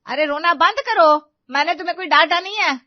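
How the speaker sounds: very loud, high-pitched order to stop crying; exasperated shouting. Acted. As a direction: snap at the sobbing bride. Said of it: "आपने गुस्से में बोला था".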